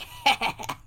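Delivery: breathy cackle, imitating gremlins; mimicked gremlin cackle. Gremlins as everybody goes at once, mimicked cackle. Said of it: "of course they're like".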